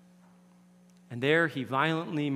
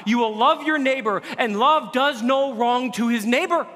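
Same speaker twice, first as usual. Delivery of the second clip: louder, higher preaching, slowed on the key lines; impassioned preaching; 10 semitones higher, 8 dB louder than usual. Acted. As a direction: lift it up and proclaim. Peak of the sermon.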